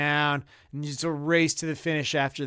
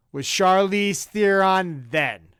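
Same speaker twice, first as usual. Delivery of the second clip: ordinary talk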